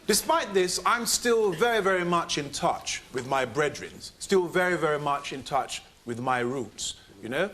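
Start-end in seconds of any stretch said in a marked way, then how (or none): none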